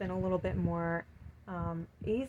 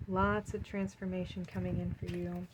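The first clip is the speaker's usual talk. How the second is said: usual speaking voice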